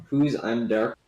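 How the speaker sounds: usual speaking voice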